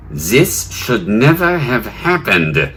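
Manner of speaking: slow, emphatic mock German accent; hammed-up German accent, each word drawn out. German official quoted in the news, in a slow mock German accent.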